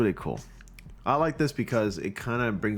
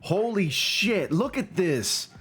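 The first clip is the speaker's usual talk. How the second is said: much higher, slow astonished cry; amazed exclaim; 9 semitones higher, slower than usual. Real, not acted. Reviewer stunned by a viewer's Spider-Man photo, amazed high exclaim. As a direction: gasp it out in disbelief.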